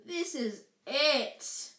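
slow, despairing moan. Acted. Ron at the locked door, moaned. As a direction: drag it out in hopeless woe. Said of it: "Ron moaned".

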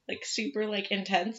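normal talking voice

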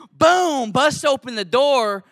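explosive, high boom; loud hammed-up sound effect; dramatized burst-in. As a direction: punch it like an action hero.

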